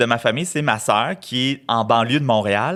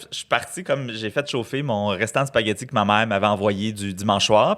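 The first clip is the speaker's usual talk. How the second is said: usual speaking voice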